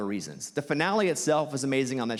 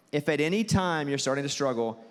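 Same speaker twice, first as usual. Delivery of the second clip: ordinary delivery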